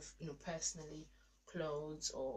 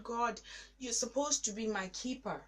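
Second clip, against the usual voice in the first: tearful plea; 4 semitones higher, 3 dB louder than usual. Retold or imitated. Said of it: "I broke down and I cried and I said".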